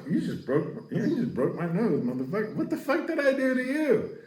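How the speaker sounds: hurt victim. Retold or imitated. Victim of the punch complains.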